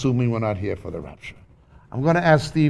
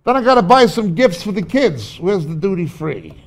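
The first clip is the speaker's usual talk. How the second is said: hurried traveller; 6 semitones higher, 5 dB louder than usual. Acted. Acting out airport hassle.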